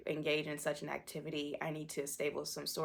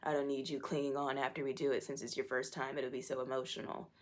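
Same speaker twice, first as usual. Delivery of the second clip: ordinary talk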